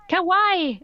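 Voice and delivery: hammed-up anime cheer; mock happy kawaii. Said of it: "I commented happily".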